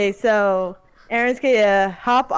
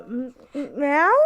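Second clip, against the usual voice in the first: hesitant meow; 5 semitones higher, 3 dB quieter than usual. Acted. Cat player meows hesitantly.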